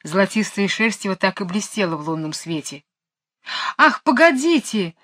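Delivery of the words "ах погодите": calling out. A narrator's reading. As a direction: call after him, high. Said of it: "крикнула было ему вслед Джейн". (окликает вслед, высоко)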